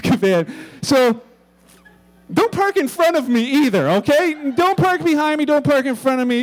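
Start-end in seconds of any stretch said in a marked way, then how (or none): 2.3-6.4 louder joking warning; playful stern notice to the listeners; mock announcement. Real, not acted. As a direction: laughingly warn the congregation. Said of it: "after this announcement".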